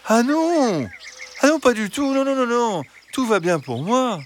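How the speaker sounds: high, eager fox voice; high fox protesting. A narrator's reading. Fox denying any danger to the hens, high and eager. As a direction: brush off the worry brightly.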